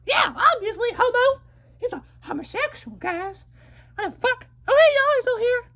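very high and loud, picking a fight; high squeaky fighting voice. Acted. Little creature squaring up to the stranger, high and loud. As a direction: squeak out a challenge.

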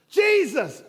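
high, loud, slow cry for mercy; desperate shout. Acted. Blind man crying out to Jesus over the crowd, loud and high. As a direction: shout it out, stretched and pleading. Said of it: "And he says".

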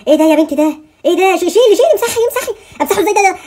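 high, loud panicked squeal; startled and flustered by the filter; rising panic at the screen; alarmed. Real, not acted.

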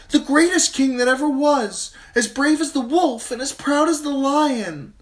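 put-on high, loud dreamy girl; girlish Sansa voice. A narrator's reading. Daughter defying her father, high and gushing. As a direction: gush about the prince like a besotted child.

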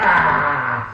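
lightly voiced monster, growling slowly at the door; a hint of a beast about to chew the door; mock monster growl. Prankster playing the mutant to scare the commissioner, mock growl.

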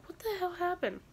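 high, hushed disbelief; shocked self-quote. Retold or imitated. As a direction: react like you just saw blue hair. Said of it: "I'm like".

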